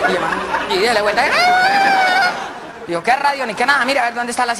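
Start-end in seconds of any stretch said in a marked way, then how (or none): 0.8-2.3 drawn-out falsetto shriek; high slow squeal. Acted. Turning the radio knob, a long high squeal. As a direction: stretch out a high radio screech.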